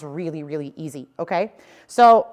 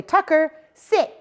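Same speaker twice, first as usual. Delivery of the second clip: a firm, slightly raised "Tucker, sit"; trainer command; 8 semitones higher, 3 dB louder, slower than usual. Shown as a demonstration. Trainer demonstrating a sit command to the dog. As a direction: call the dog's name then the command. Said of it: "So I'll say".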